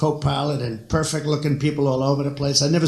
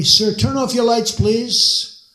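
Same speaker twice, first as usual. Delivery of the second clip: raised, urgent plea; loud urgent pilot; 8 semitones higher, 4 dB louder, much slower than usual. Retold or imitated. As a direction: call it out higher and louder, pressing. Pilot voiced in story.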